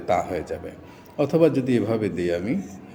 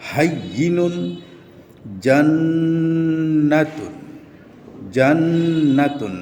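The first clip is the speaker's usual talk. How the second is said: recitation drill; 3 semitones higher, slower than usual. Shown as a demonstration. Recites Quran reading drill words.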